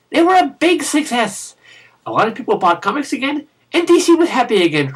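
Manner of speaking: chirpy picture-book telling; reading to children. Pretend. Storybook history for kids.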